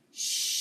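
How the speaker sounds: long hard shush from the belly; firm shush. Shown as a demonstration. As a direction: shush firmly and hold it. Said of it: "we're gonna shush hard for three seconds".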